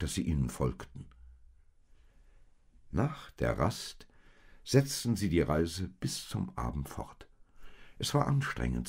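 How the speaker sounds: ordinary delivery, nothing marked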